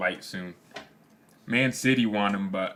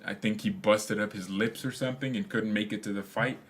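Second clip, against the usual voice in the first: ordinary talk, nothing marked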